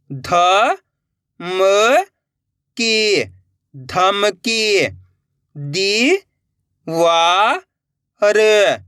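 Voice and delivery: slow, drawn-out sounding out with pauses; syllable-by-syllable reading. Shown as a demonstration. Teacher showing learners how to join letters, sounded out slowly. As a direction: stretch each letter, then join the word slowly. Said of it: "एक एक अक्षर का उच्चारण करके".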